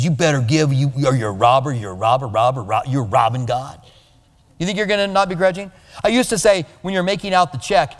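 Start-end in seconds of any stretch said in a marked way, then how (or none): 0.0-3.8 lower, mock scolding preacher voice; mock accusing preacher. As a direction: growl it like a finger-wagging preacher. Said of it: "If the preacher looks at you and says".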